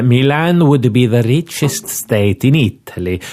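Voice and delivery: put-on a pompous Milanese inspector in an Italian accent. Inspector introduces himself to Scotland Yard, Italian accent. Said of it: "to do the accent".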